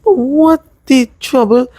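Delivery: high, slow offended "what the trouble"; a high, drawn-out complaint; offended protest. Retold or imitated. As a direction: protest with wounded disbelief. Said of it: "I was so offended".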